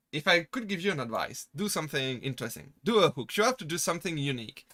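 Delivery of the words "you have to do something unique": usual speaking voice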